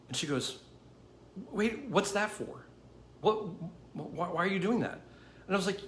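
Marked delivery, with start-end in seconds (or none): 1.5-5.0 higher, halting puzzled questions; retold bewilderment. Retelling woman questioning a stranger's twenty dollars, bewildered. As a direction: stammer it in mild disbelief.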